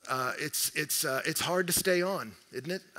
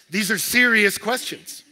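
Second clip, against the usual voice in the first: serious hit hard, louder and slowed down; emphatic preaching; 6 semitones higher, 12 dB louder than usual. Real, not acted. Preacher to congregation, serious stressed loud and slow.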